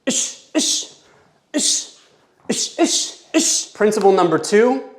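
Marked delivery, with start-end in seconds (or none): 0.0-3.7 whispered "ish" exhales, quick bursts; sharp breaths with punches. Shown as a demonstration. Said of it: "On every punch, you breathe".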